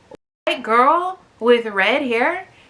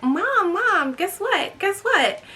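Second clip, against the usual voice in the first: higher eager voice calling mom; lightly voiced excited teen; eager daughter; 8 semitones higher, slower than usual. Daughter bursting to tell her mom news, higher eager voice. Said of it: "She was like".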